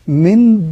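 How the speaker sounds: "من" with a clear nun said hard before the ba; heavy wrong version. Shown as a demonstration. Teacher shows the awkward pronunciation, pushed and heavy. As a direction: push a stiff nun in "من" to show how awkward it is. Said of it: "في ثقل وصعوبة في النطق".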